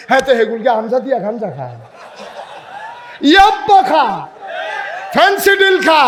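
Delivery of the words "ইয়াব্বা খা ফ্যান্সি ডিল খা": mocking shout. Preacher mocking drug habits, shouting.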